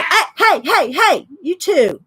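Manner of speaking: high, repeated hey to get attention; sharp calling out. Real, not acted. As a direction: break off and call the two to order. Breaks off to scold pair nearby.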